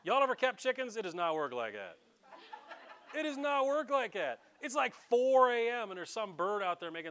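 high, loud exasperated protest; loud comic incredulity. Real, not acted. Preacher debunking the storybook rooster to his congregation, loud exasperation. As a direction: burst out in disbelief.

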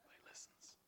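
whispered aside. Acted. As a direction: breathe it out as a secret. Child obeys dad's voice.

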